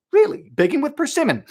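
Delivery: high, loud disbelieving really; surprised. Real, not acted. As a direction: react in sudden surprise. Surprised by a recipe offer.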